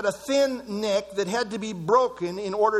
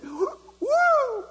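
high-pitched greedy thief; gloating thief; 13 semitones higher, much slower than usual. Retold or imitated. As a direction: crow like a thief counting his loot. Judas gloating over money box.

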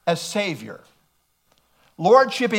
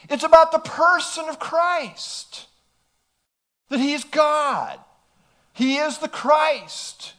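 normal talking voice

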